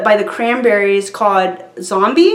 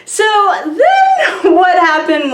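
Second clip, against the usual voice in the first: high burst of laughter; laughing; 9 semitones higher, slower than usual. Real, not acted. After the crowd shouts yes, laughing. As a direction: crack up then say okay.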